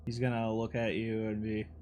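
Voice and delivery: normal talking voice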